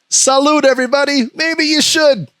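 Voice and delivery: high-pitched silly proclamation; put-on voice. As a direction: pipe it up in a high mock voice. Sudden put-on voice, then repeats plainly.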